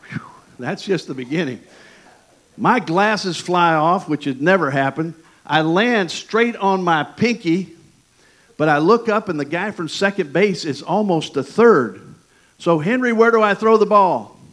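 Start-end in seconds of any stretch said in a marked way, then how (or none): 2.6-14.4 excited storytelling. Acted. Dramatic baseball story climax.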